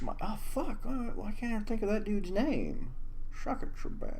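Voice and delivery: a hint of a cartoon snowman. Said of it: "my snowman voice".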